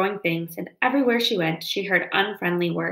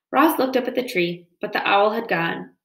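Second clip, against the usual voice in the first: ordinary delivery, nothing marked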